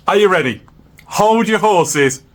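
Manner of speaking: ordinary talk, nothing marked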